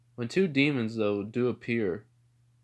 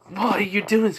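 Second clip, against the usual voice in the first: high, loud, anguished cry; acted pained scream; 10 semitones higher, 8 dB louder than usual. Demon being cut up by Tanjiro, loud pained cry. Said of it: "As they're screaming in pain".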